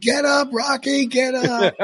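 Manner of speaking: high-pitched mock scream without much volume; strained, high cheer, imitating a screaming crowd; retold screaming. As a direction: re-enact a moviegoer frantically urging on a fighter. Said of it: "everybody was screaming like it was a real fight".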